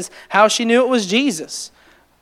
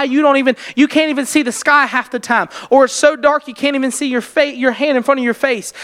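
building preaching; 4 semitones higher than usual. Acted. Preacher encouraging his congregation through trials, rising fervor. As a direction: lift the congregation with mounting fervor.

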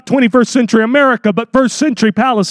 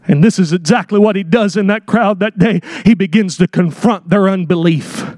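ordinary delivery

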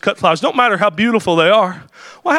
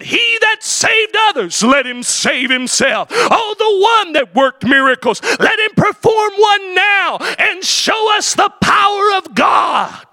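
higher, sing-song jeering call; jeering crowd; 10 semitones higher than usual. Retold or imitated. Crowd at the cross jeering at the Lord, sing-song and much higher. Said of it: "they jeered at him and they said".

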